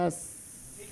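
a long, breathy yas, not yes; drawn-out yas. Shown as a demonstration. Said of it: "I would like the yas to be more of a yas".

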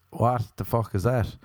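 usual speaking voice

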